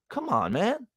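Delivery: ordinary talk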